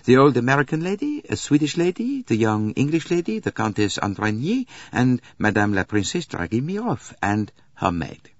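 high-pitched conductor's list. Acted. Conductor character listing passengers.

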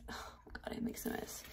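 hushed, dismayed whisper. Real, not acted. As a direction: whisper it at the mess. Spilling pancake mix.

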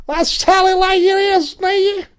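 mock proclamation. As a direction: declare it in a silly high voice. Mock declaration in high voice.

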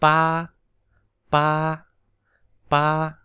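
pa held high; first tone pa. Shown as a demonstration. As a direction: keep pa high and level. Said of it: "cũng thanh nhất luôn".

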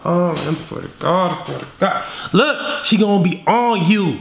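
ordinary talk, nothing marked

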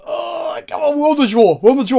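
loud, high burst of frustration; almost shouting at the draw; exasperated outburst. Real, not acted. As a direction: come on, we're on the draw, loud and fed up.

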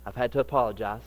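ordinary talk, nothing marked

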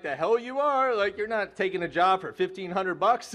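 scolding mom, retold with a laugh; mom's outraged objection. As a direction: play the mom refusing to let her son take the job. Mom upset about job offer.

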